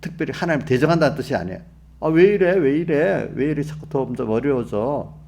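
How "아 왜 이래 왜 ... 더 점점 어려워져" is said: mock resentful complaint. Preacher imitates someone resenting growing hardship, mock exasperated complaint. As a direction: grumble in put-on exasperation, repeating the protest. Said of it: "이상하게 여기고 원망하는 게".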